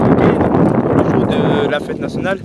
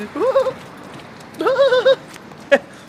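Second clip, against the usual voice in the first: high laugh. Real, not acted. Camera detects her, laughs.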